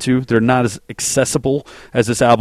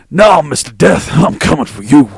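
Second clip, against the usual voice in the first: a louder second try at a friend's drawl; 4 semitones higher, 6 dB louder than usual. Retold or imitated. Host retrying his buddy's voice, louder. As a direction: mimic the singer one more time. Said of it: "But it's like".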